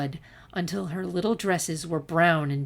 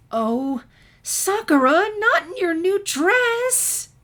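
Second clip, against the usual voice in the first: high, loud cry of dismay; 13 semitones higher, 10 dB louder, slower than usual. A narrator's reading. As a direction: call out to her muddy daughter in exasperation. Mother sees muddy daughter.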